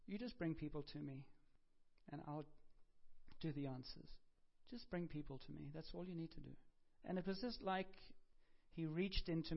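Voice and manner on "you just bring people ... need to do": softly spoken, tender reassurance; gentle. Retold or imitated. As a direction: say it softly, as a gentle instruction from God. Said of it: "he just said really gently".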